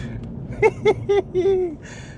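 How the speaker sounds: long high delighted laughing fit; giddy laughter. Real, not acted. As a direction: laugh hard with pure thrill. Laugh after drift.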